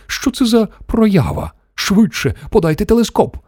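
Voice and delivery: high, excited call for action; high-pitched yelling. A narrator's reading. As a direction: yell it high without much extra volume. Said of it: "заволав Шакворд".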